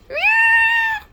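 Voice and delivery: piercingly high, loud meow; long squealed cat cry. Acted. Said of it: "Can you go find that cat?".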